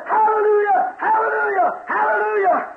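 high, loud hallelujah shouts; joyful shouting. Acted. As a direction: shout praise for joy. Old man shouting for joy.

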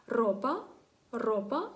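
ропа with a rolled trilled р; strong rolled r. Shown as a demonstration. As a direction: roll the р hard in ропа. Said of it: "чуєте сильний звук". (ропа з розкотистим сильним р)

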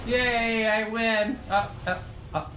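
normal talking voice

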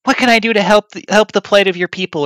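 high, loud imitation of an eager helper.

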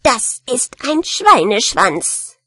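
slow, emphatic angry rat; voller Zorn. Acted. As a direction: correct them hotly, drawing it out. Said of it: "schrie die Ratte voller Zorn". (zornige Ratte, langsam und nachdrücklich)

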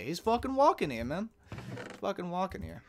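a hint of a Brooklyn accent; lightly Brooklyn, fading down. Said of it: "I can do Brooklyn".

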